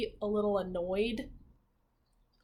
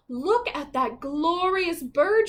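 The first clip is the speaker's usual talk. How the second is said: higher, slow, admiring cry of a princess; cried out; 6 semitones higher, 7 dB louder, slower than usual. Acted. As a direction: gasp it out in delight. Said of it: "she cried".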